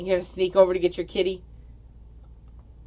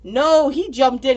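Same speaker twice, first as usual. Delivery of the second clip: ordinary talk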